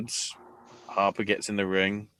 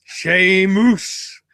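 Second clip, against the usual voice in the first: high, loud Irish call; Irish accent; 10 semitones higher, 9 dB louder than usual. Pretend. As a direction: shout the name in an Irish accent. Names Irish wrestler after accent.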